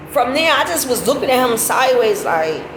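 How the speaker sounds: normal talking voice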